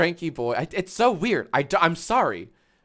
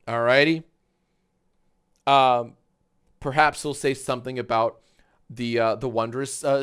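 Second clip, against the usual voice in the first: ordinary talk, nothing marked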